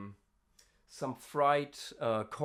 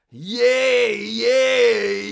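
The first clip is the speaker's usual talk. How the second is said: fry scream; 3 semitones lower, much slower than usual. Shown as a demonstration. Scream vocal demos.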